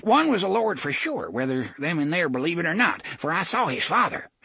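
put-on high, earnest old man. A narrator's reading. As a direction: tell it like an old gossip. Old man continues tale.

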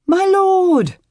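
high, loud urgent call; urgent shout. Acted. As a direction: call out in alarm. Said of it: "Sir Rolf's voice stopped him".

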